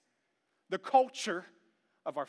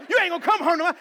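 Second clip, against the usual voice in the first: scolding parent; 7 semitones higher, 8 dB louder, much faster than usual. Retold or imitated. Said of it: "But you go".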